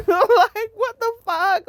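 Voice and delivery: high laughing what the fuck; giddy laughing shock; laughing disbelief. Retold or imitated. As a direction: laugh it out in amazement. Kid's joy at Disneyland.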